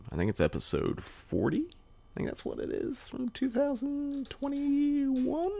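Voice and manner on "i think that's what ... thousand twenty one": unsure muttering. Real, not acted. Unsure of episode date.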